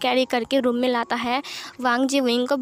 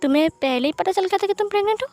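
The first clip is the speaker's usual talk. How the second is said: higher, rapid incredulous question; surprised; 6 semitones higher, faster than usual. A narrator's reading. Wang Ji learns Wing already knew of the pregnancy, surprised. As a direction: blurt it out fast, rising in surprise. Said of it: "वांग जी हैरान था".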